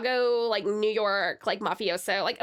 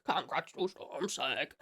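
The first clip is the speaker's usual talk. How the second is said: low, soft and slow; slow, low-pitched soft echo of a cheer; hushed congratulations; 11 semitones lower, 9 dB quieter, slower than usual. Pretend. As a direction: drop right down and draw it out quietly. Repeats congratulations, dropped right down.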